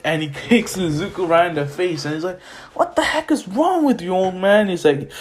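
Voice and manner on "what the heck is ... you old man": high-pitched mock outrage; indignant protest. Izuku protesting a kick from Gran Torino, indignant. Izuku just kicked in face.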